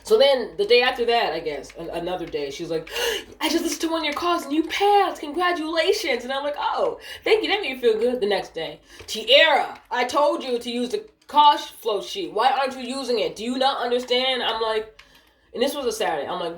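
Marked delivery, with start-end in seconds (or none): 3.2-6.2 high-pitched, excited mock supervisor; excited congratulations. Supervisor telling an agent she passed a call review, excited. Supervisor delivering good news. 6.6-8.2 higher-pitched, delighted retold thanks; pleased thanks. Call agent thanking supervisor for praise, pleased. 9.0-14.4 high-pitched, exasperated mock supervisor; scolding. Supervisor scolding Tiara over the call flow sheet, exasperated.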